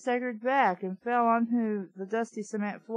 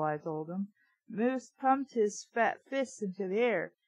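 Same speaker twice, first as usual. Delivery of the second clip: plain reading voice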